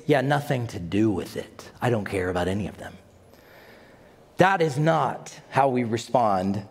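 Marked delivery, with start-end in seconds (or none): 0.1-2.9 low, quiet, fast brush-off; a hint of careless shrug; dismissive. Imitating fearful Christians, quietly dismissing the world. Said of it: "In our version, it's".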